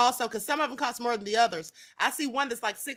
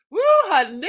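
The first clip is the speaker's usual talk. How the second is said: loud laughing woo; 3 semitones higher, 9 dB louder than usual. Real, not acted. Recovering from laughter.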